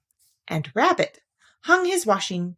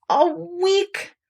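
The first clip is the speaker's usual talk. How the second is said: gloomy; 11 semitones higher than usual. Acted. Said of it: "said Pooh gloomily".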